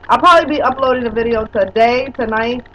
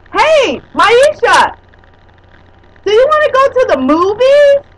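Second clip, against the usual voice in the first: put-on high, loud kid calling a parent; imitating a kid; 9 semitones higher, 7 dB louder, slower than usual. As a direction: mimic a child begging to go to the movies. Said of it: "Just like a kid, huh?".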